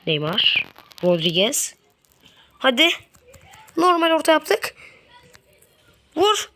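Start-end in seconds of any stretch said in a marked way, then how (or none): 2.6-6.5 high, pushing cry to shoot; urging shout. Real, not acted. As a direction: yell for the shot. Urging shot.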